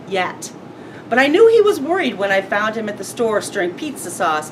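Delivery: ordinary delivery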